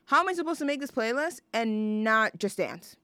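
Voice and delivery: usual speaking voice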